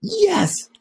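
chirpy little cub saying yes at once; eager cubs. Acted.

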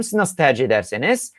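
ordinary talk

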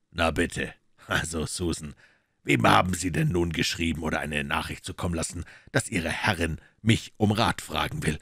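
cool detective teasing the maid; dry detective. Acted.

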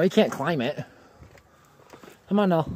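normal talking voice

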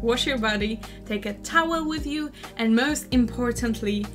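ordinary delivery

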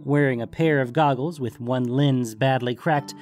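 usual speaking voice

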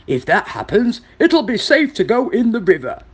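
lightly voiced wild pig; grunting wild pig. A narrator's reading. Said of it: "grunted a wild pig".

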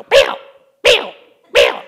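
a correcting teacher mother calling her husband; nagging mother. Retold or imitated. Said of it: "She'd go".

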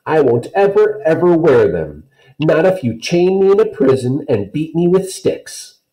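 put-on high, loud defiant little girl; Kirsty protesting to Mama, high defiant child; stubborn child. A narrator's reading. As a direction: dig in your heels, loud and high. Kirsty refusing shoes.

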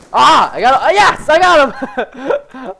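loud, high triumph into laughter; elated shout. Real, not acted. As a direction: yell in surprised triumph, then laugh. Celebrates a kill.

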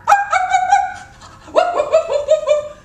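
squealing high loud woofs; high excited barks. Acted. George finally barking for the vet, high and loud. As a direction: yap like a thrilled puppy. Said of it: "And George went".

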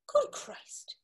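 breathless, stunned whisper of disbelief; barely voiced, as if the news knocked the wind out; shocked whisper. Alf whispers on hearing that his friend Paddy is dead.

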